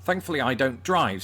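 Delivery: ordinary talk, nothing marked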